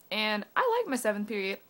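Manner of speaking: ordinary delivery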